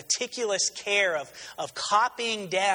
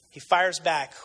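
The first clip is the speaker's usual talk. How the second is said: ordinary delivery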